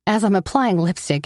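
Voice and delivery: plain reading voice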